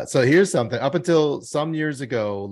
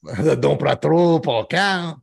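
mock French accent; 5 semitones higher, 6 dB louder, slower than usual. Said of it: "in France".

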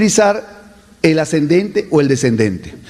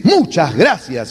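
"muchas gracias" drawn out slowly with weight; strong thank-you; emphatic; slower than usual. Shown as a demonstration. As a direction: lean hard on "muchas gracias". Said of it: "Una cosa es decir".

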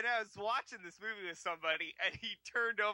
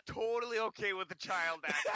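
ordinary talk

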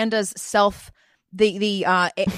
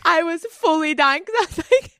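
laughing; 11 semitones higher than usual. Real, not acted. Friends crack up over bad joke.

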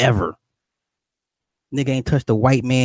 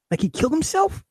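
incredulous. Real, not acted. Disbelief at the cartoon ending.

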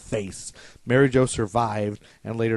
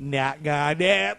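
slow, higher-pitched comic presidential impression; Dana Carvey's Bush; 4 semitones higher, much slower than usual. Host mimicking a TV skit about the vomiting, drawn-out comic impression. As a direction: draw the words out like a sketch-show president. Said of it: "Dana Carvey was all over that shit".

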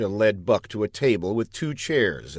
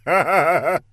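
self-pleased laugh; 7 semitones higher, 4 dB louder than usual. Acted. Buck laughs at own pun.

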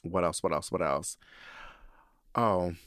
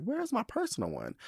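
panicked high question; 14 semitones higher, 4 dB quieter than usual. Retold or imitated. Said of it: "but I was like".

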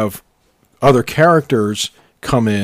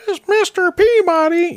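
put-on much higher whiny boy voice; Sherman voice. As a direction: whine it like a cartoon kid. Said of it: "wouldn't Sherman always go".

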